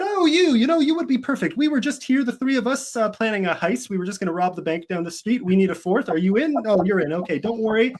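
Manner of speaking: rope a stranger in with playful high-voiced patter; joking old guy. Old guy recruiting a stranger for a pretend heist, gleefully joking.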